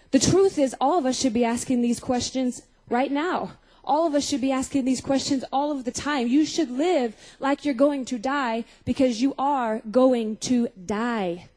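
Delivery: ordinary talk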